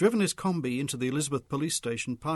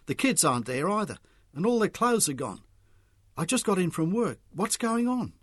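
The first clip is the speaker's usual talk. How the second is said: high-pitched agitated outburst; urgent and distressed, pitch climbing; 7 semitones higher, 4 dB louder than usual. A narrator's reading. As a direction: reel it off, increasingly worked up. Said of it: "Mick had become more agitated".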